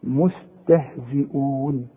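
مستهزئون recited slowly, every letter light; slow recitation of مستهزئون; thin letters. Shown as a demonstration. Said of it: "برضو مرققة".

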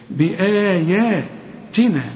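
بآياتنا drawn very slowly, vowels stretched; slow tajweed reading; recited with madd. Shown as a demonstration. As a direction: chant بآياتنا with long even vowels. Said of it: "وهكذا يقرأ".

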